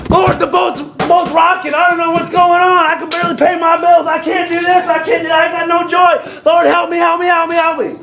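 high, breathless run of complaints; hammed-up whining plea, piling up without a break; spoiled child's panic. Preacher mimics believers begging the Lord, spoiled child's panic. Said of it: "like a spoiled little child".

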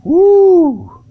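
whoop, then high, driving pulpit questions; fervent preaching. Real, not acted. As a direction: exhort the church with rising fervor. Preaches at full force.

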